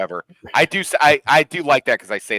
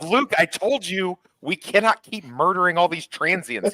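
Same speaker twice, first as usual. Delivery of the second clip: mock scolding; 8 semitones higher, slower than usual. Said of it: "I'm like".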